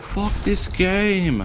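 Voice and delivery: high, slow, stretched mock rage yell; mock rage at the game, dragged out; Sansa impression. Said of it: "That was my best Sansa impression".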